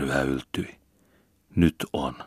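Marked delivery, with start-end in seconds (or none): none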